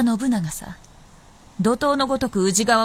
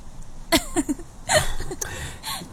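teasing laugh; 7 semitones higher, 3 dB quieter than usual. Acted. Fox teases young fox.

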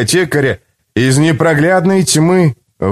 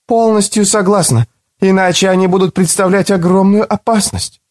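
grim woman voice; 9 semitones higher than usual. A narrator's reading. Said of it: "угрюмо кивая".

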